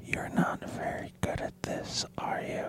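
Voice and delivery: slow, hushed whisper. Acted. Advisor leans into your ear, whispering slowly. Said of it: "and he whispers".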